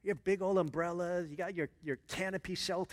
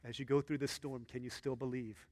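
soft sermon; 8 semitones lower, 9 dB quieter than usual. Real, not acted. Pastor speaking softly to the congregation, hushed sermon. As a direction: preach gently, coaxing the listeners to trust.

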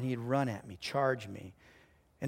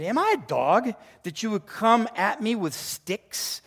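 raised, slower challenge in a Bible reading; lightly voiced indignant Goliath; 7 semitones higher, 5 dB louder than usual. Preacher reads Goliath taunting David, mildly indignant. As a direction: read it as an affronted taunt, lightly acted. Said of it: "And he said to David".

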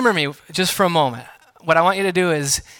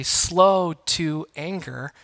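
Slow to anger said very slowly, each word separated; slow, deliberate; much slower than usual. Shown as a demonstration. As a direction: let the words themselves go slow. Summing up the phrase.

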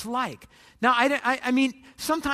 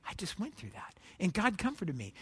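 soft, lowered voice, thinking to yourself; quiet inner thought; 4 semitones lower, 9 dB quieter than usual. Acted. Listener thinks back on own trouble, soft and low. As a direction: say it low, as a private realization. Private thought on hearing another's pain.

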